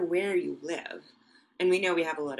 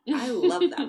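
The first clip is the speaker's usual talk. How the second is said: excited; 13 semitones higher, 8 dB louder than usual. Real, not acted. Gushing about geckos.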